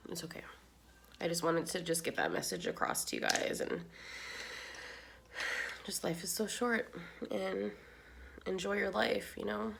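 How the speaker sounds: very soft, subdued after crying; quietly composing oneself through tears; real hushed, emotional voice; tearful. Real, not acted. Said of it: "I cannot believe I've cried".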